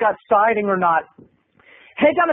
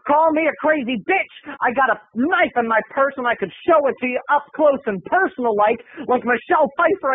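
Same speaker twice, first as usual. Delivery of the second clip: high, menacing crazy-lady voice; hammed-up threat from a madwoman; crazy duck lady; 6 semitones higher than usual. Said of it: "And she's like".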